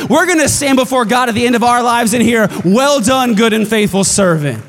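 loud, high, driving preacher climax; full-force preaching. Acted. Pastor rallying his church to multiply, full-force preaching. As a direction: shout it to the rafters like a revival.

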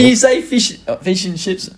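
high-pitched, eager imitation of a fan; mock accent fan. Accent fan asking a New Zealander for a phrase, mock high-pitched request. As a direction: imitate someone begging to hear an accent, voice jumping high. Mimicking people fascinated by the accent.

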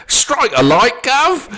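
loud, high, hammed-up Cockney; jokey exaggerated Cockney shout; Cockney accent. Shown as a demonstration. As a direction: burst out in mock Cockney, laughing. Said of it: "He's gonna go Cockney in a minute".

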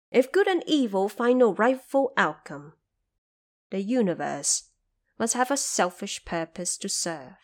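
ordinary delivery

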